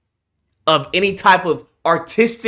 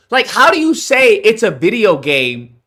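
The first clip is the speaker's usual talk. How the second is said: ordinary delivery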